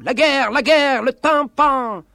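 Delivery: shouted high, the last words drawn out slowly; declaimed shout. Acted. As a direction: hurl the war cry at the listener. Recited war clamour, shouted. (grido declamato, acuto e lento alla fine)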